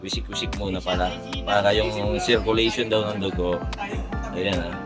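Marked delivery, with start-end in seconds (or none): none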